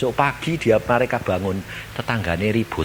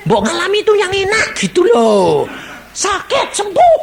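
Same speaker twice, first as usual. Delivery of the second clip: mock whining wish; 5 dB louder than usual. Mocking what believers want.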